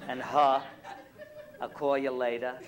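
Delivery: loud, broad Brooklyn vowels; Brooklyn accent. Shown as a demonstration. Comic showing a local audience their speech, heavy Brooklyn accent. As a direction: punch out the thick Brooklyn sounds. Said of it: "that's how you speak".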